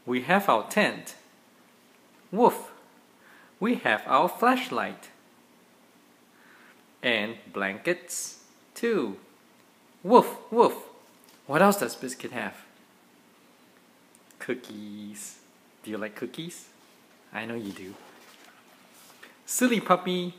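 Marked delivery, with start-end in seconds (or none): 2.3-2.7 a single high, loud woof; puppy bark. Acted. Dad reading a bedtime book, barking as Biscuit. 10.0-10.7 high, loud playful woof woof; puppy bark. Acted. Dad reading to his kids, barking loudly as the puppy. 14.4-17.9 soft aside. Real, not acted. Dad speaks softly to kids.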